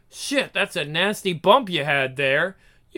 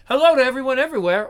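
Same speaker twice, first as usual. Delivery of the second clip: rattled off quickly and a bit higher; fast hammy greeting; 4 semitones higher, 3 dB louder, much faster than usual. Shown as a demonstration. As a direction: rush the hello like a showman. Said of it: "we'll do this one again".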